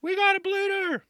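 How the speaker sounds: high, loud mock announcement; party guy calling out. Guy at the party announcing an injury, mock-alarmed shout. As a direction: yell it out in a high put-on voice. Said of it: "I'd be that guy at the party".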